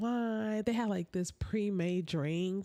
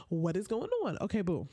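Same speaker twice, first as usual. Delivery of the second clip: normal talking voice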